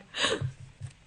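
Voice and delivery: breathy theatrical gasp; dramatic gasp. Acted. Cleopatra hearing bad news, a hammed-up gasp. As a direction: suck in air, shocked. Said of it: "She's like".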